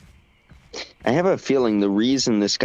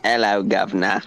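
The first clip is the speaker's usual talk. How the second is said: cliché British "governor" greeting accent. Shown as a demonstration. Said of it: "I'm gonna put on a very stereotypical accent".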